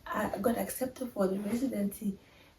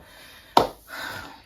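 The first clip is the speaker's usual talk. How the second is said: heavy, wordless exhale; long breath of defeat; exasperated sigh. Real, not acted. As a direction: let out a big sigh. Exasperated after losing dream job.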